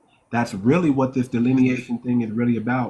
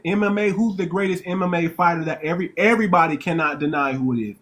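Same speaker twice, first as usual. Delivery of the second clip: usual speaking voice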